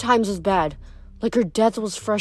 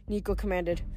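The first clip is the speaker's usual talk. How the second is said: ordinary delivery, nothing marked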